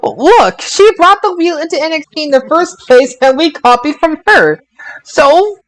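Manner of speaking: indignant rising argument; heated protest. Real, not acted. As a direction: push back hard, voice climbing. Heated argument over wrestling wheel.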